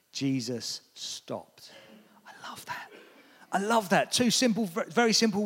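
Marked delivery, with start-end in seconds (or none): 2.3-2.9 soft unvoiced whisper of delight. Real, not acted. Preacher savouring the moment, whispered. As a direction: breathe it to yourself, awed. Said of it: "whispered then repeated".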